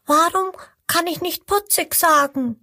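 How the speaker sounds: high, puzzled little croc voice; little crocodile asking. Acted. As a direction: ask innocently in a tiny high voice. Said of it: "fragt das kleine Krokodil".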